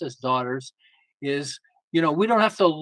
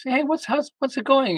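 breezy check-in; 9 semitones higher than usual. Retold or imitated. Casual check-in call to daughter.

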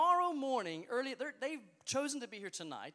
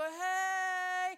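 a big high shouted "Hey!"; loud cheer for the crowd; 8 semitones higher, 4 dB louder than usual. Shown as a demonstration. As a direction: show them the shout you want. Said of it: "scream and shout".

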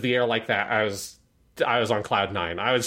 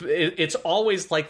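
ordinary talk, nothing marked

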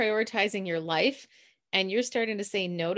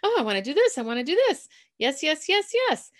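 put-on high, eager overexcited teen; breathless schoolgirl saying yes to everything; eager Marcia Brady; 8 semitones higher, 5 dB louder, faster than usual. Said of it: "Marcia Brady was signing up for every single possible extracurricular activity".

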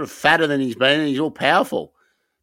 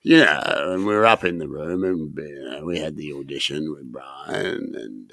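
Chisora impression; 12 semitones lower, 4 dB quieter than usual. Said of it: "That's what he sounds like".

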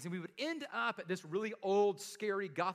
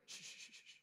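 hushed, breathy shushing to a group; spooky whispered shh; 17 dB quieter than usual. Retold or imitated. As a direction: shush the kids as if something is out there. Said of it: "I'm like".

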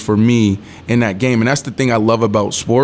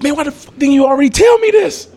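much higher, louder, incredulous yell; exasperated outburst; 4 dB louder than usual. Retold or imitated. Outraged at teammate's silence.

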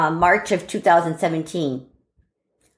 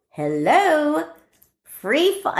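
high, drawn-out mock cheer; gleeful; 8 semitones higher, much slower than usual. Daughter alone with the attic, gleeful. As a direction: re-enact delight at free rein.

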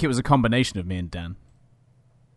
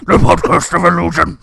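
higher, loud put-on title voice; hammy announcer; 4 semitones higher, 7 dB louder than usual. Host tries another title take, loud and hammy. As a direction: belt out the title with mock drama.

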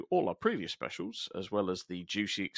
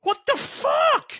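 high, loud, slow disbelieving shout; stunned what-the-hell yell; mock shout; 11 dB louder, slower than usual. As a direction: shout it like the riff just floored you. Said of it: "everyone just went".

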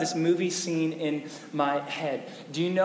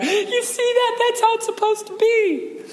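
much higher put-on voice, gushing; mock gushing women; 4 dB louder than usual. Pastor mocking the divorcee club cheering, high gushing voice. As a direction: squeal it like a gushing woman.

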